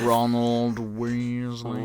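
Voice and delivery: a light hint of young Ron; Ron Weasley voice. Friend answering the game, mock Ron voice, slowly. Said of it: "naming Ron, impersonating".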